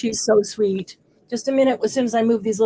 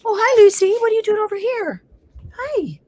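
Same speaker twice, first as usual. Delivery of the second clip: high, delighted hello to a dog; pet-talk greeting; 11 semitones higher, 5 dB louder than usual. Real, not acted.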